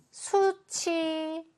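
수치 high and very drawn out, su-chi; slow, high model reading of 수치; demonstrated linking pronunciation. Shown as a demonstration. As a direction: stretch both syllables of 수치 slowly. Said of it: "이렇게 읽으셔야 돼요".

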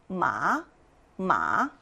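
마 gliding upward from low; second tone. Shown as a demonstration. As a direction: start each 마 low and lift it. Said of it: "올라갑니다".

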